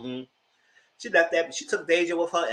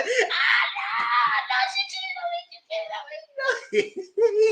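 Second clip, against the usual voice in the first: very high, hammed-up wail; mock wailing; much slower than usual. Storyteller mimics husband pacing over cheating wife, mock high wailing.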